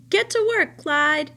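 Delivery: high, loud bossy command; loud order. Acted. Old woman ordering the scarecrow rabbit to work, loud. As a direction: bark it high and loud at him.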